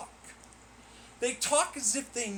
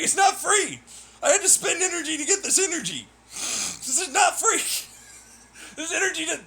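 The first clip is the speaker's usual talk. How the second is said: higher and louder, a half-acted angry outburst; mock furious rant; 8 semitones higher, 5 dB louder than usual. Gamer yells back at free-energy fans, mock furious. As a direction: yell it back at them, exasperated. Retorting angrily at imagined believers.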